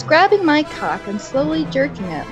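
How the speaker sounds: ordinary delivery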